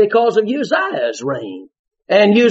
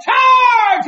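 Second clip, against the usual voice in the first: battle cry. Retold or imitated. Someone shouting the charge to the army. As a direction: yell it like a battle order.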